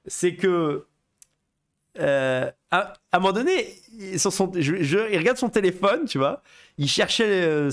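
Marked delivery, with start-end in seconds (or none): none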